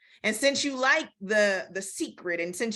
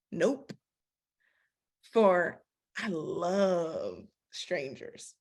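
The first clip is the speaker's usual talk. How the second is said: defiant drawl; 4 semitones lower, much slower than usual. Retold or imitated. Israel voiced, slow defiant reading.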